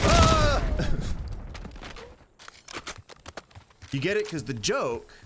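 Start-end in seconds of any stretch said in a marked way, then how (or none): 0.0-0.6 high, loud "ah"; loud high yell; startled scream. Real, not acted. Startled during the game. 0.7-2.1 high breathy laugh; breathy giggle trailing away; laughter. Real, not acted. Laughs after own scream.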